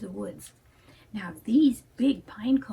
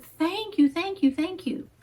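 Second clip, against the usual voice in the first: put-on grateful boy; 5 semitones higher than usual. Grateful boy thanks painter.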